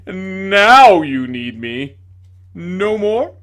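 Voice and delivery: loud, slow theatrical tragedian; hammed-up despair. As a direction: overact the despair like a ham actor. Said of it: "with a hackneyed gesture of dis-, despair".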